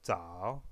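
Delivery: "zao" dipping low, then climbing back up; falling-rising tone. Shown as a demonstration. Teacher giving an example word in dipping third tone. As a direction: sink low on "zao" and swing upward. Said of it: "C'est donc le ton descendant montant".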